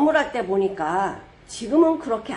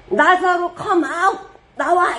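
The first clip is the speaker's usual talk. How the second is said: loud call; 6 semitones higher, 4 dB louder, much slower than usual. Retold or imitated. Jesus calling Lazarus out.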